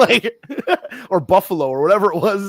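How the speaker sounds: laughing through the words, high and loud. Real, not acted. Retelling the wrestler's accent dispute to co-host, laughing. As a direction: crack up while talking, pitch jumping up. Laughing at own retold quote.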